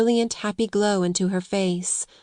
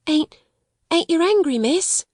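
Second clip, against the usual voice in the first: high, scared Cockney maid; gasped fear; 9 semitones higher than usual. A narrator's reading. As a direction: gasp it out, dreading punishment. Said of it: "she gasped".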